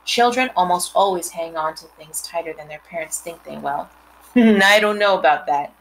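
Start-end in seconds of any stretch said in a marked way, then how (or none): none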